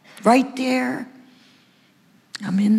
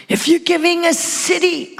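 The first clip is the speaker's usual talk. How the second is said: fervent prayer cry; 6 semitones higher than usual. Retold or imitated. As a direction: cry out your plea, raised up. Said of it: "I sobbed and I worshiped and I said".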